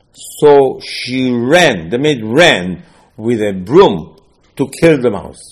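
loud and very slow, building the chase; loud slowed chase narration; excited telling. Acted. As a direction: paint the maid's chase loudly. Maid chasing mouse told.

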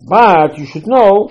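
But you should know, loud, high and slow; emphatic slow warning. Acted. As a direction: lean in and slow down to warn them. Preacher drives home point.

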